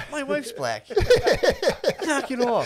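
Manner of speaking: high, loud, laughing call-out; hammed-up protest cracking into laughter; mock objection. Husband objecting about his wife, hammed-up laughing protest.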